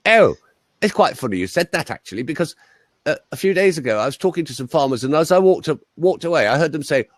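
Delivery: high-pitched posh English storyteller; upper-class English, lifted in pitch; posh English accent. Retold or imitated. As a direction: imitate a plummy countryside gentleman. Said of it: "Bosh git".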